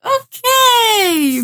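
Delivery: high, drawn-out dubious okay; taken aback. Retold or imitated. Host backing off from the weird brother, high drawn-out unease. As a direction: stretch it high in comic unease. Retelling her uneasy reaction.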